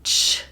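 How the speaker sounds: one sharp "Ch" burst; ch demo. Shown as a demonstration. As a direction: spit out "Ch". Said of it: "a messy sound".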